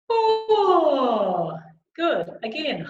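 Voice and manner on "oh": loud high "Oh" sliding slowly down; wide throat. Shown as a demonstration. Coach leading a throat-space exercise, wide and loud. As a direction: open wide on one long falling call. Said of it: "nice and wide".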